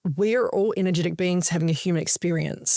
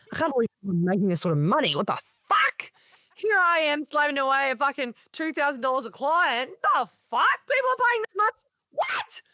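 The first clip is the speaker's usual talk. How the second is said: incredulous outburst; 7 semitones higher than usual. Retold or imitated. Coach recalling first hearing others' earnings, hammed-up incredulity. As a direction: pitch climbing into squeaky mock disbelief. Acting out past disbelief at earnings.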